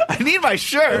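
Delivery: high, loud comic whine; mock desperate plea. Guest phoning the front desk about a shirt, mock desperate.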